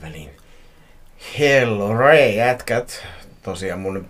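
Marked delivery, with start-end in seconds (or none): 1.4-3.1 loud, high-pitched hello; big hammy announcer hello; shouted greeting. Acted. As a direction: belt out a greeting to the guys.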